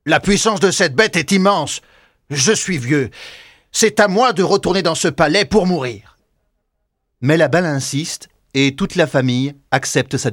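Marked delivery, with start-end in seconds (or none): 0.1-6.2 put-on higher, grave old man's voice; resigned and firm; old father. Father insisting on returning to die, resigned.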